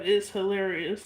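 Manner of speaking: usual speaking voice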